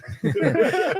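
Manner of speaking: high bright laugh; a sudden laugh; burst of laughter. Real, not acted.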